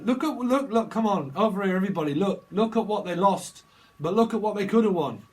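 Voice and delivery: loud, high game show host hype; hammed-up quiz host luring a losing contestant; Bob Holness host voice. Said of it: "Bob Holness".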